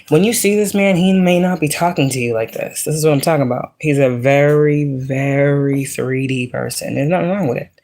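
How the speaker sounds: ordinary talk, nothing marked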